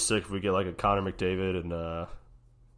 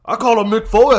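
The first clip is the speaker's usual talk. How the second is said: much higher, much louder put-on old man; old wrestling host; 16 dB louder than usual. Imitating a wrestling legend grilling a guest, hammed up. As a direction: ham up a cranky old interviewer.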